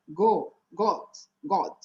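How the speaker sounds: "God" with a round Portuguese O, like "Gó-d"; learner's mispronounced "God" with an O vowel. Shown as a demonstration. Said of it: "no português a gente fala O".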